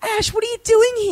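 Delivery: very high, put-on neighbour voice; mock-alarmed, pitched right up; bewildered neighbour. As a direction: imitate a neighbour catching someone out. Neighbour confronts Ash in hallway.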